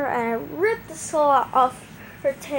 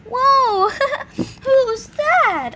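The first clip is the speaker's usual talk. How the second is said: high loud fake wonder; high, loud feigned surprise with a giggle; mock surprise; 12 semitones higher, 7 dB louder, much slower than usual. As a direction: play dumb about the wolf. Feigned innocence.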